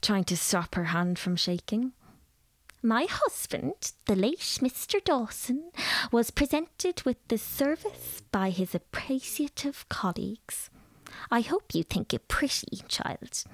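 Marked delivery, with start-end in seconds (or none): 2.8-13.4 higher lady's voice sinking to a whisper; grand old lady lowering to a hush; haughty widow confiding. A narrator's reading. Mrs Dawson shows off her late husband's tea service, dropping to a whisper.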